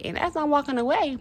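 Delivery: ordinary talk, nothing marked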